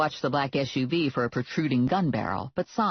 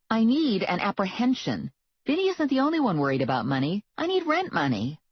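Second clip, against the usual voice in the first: higher and quicker, fretting; worried young woman pleading; higher anxious heroine voice; 6 semitones higher than usual. A narrator's reading. Heroine's voice, stressed about money.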